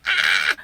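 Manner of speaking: short, loud cartoon gasp-scream; comic little mock shriek; cartoon mouse shriek. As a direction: imitate a startled cartoon mouse. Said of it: "cartoons where the little mouse goes".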